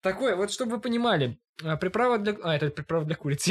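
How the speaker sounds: normal talking voice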